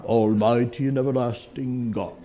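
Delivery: slow, solemn mock liturgy fading to a whisper; formal prayer. Pastor mocking stiff formal prayers, slow and solemn. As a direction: intone it like an old prayer book. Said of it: "Our prayers don't have to be".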